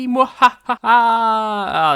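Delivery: loud hammed-up evil cackle; a big theatrical mwahaha; evil villain laugh. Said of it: "that's, uh, enough of that".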